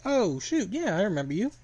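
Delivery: high-pitched, sudden recollection; high mock surprise; surprised recognition. Character recognises old academy mate.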